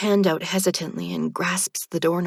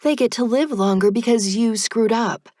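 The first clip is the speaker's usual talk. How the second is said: higher, louder sarcastic jab; bitter sarcastic retort; 5 semitones higher, 5 dB louder than usual. A narrator's reading. Narrator mocks the reaper with bitter sarcasm. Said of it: "I comment sarcastically".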